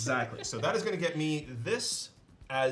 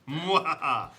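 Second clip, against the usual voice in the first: evil laugh; 2 semitones higher, 5 dB louder than usual. Acted. Villain gloating over ingredients.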